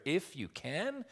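"If you can" slow, climbing high on CAN; high, slow, rising "If you can"; emphatic exclamation. Acted. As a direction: stretch it out and lift CAN like a disbelieving question. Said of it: "That's that emphasis behind the words".

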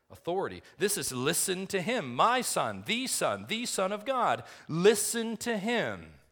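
high, loud, slowing to a commanding end; thundering sermon climax. Acted. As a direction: proclaim the authority of God with full force. Preacher rises to full force.

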